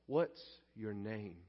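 slow, low, soft question; calm, loving. Acted. Jesus asking the possessed man his name, slow and loving. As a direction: ask tenderly, taking your time. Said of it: "very calmly, very lovingly".

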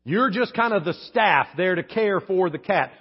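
ordinary talk

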